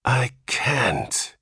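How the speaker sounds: whispered, despairing moan; horrified father moans, half whispered. A narrator's reading. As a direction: moan it in hopeless dread. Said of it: "he moaned".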